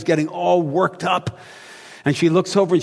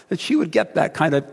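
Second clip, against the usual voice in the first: ordinary talk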